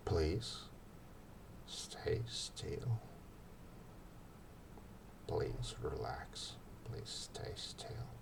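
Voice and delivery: soft, slow whispered calming words; hushed hypnotic whisper; soothing whisper. Shown as a demonstration. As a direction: breathe it gently, like soothing a patient. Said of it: "Instead of saying, "Don't run around," you say".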